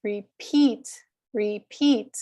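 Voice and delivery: re-PEAT, the PEAT punched and longer; second-syllable stress. Shown as a demonstration. Teacher leading students together, Repeat stressed on PEAT. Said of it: "then it goes to the stressed syllable".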